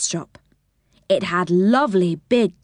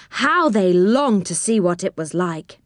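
ordinary delivery, nothing marked

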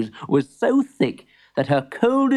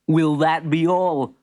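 laughing; 3 semitones higher, slower than usual. Acted. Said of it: "laughed Mister Misfit".

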